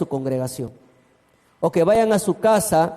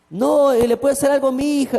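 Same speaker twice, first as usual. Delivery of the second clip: 1 worried neighbour; 6 semitones higher than usual. Retold or imitated. Neighbour protesting in fear.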